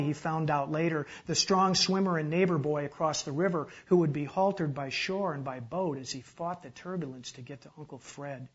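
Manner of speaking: ordinary delivery, nothing marked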